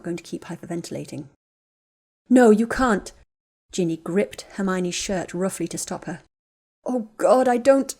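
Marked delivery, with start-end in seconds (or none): 2.3-3.1 desperate plea. Acted. Ginny grips her to stop her. 6.9-7.9 sobbing. Acted. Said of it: "Ginny started sobbing into Hermione's shoulder again".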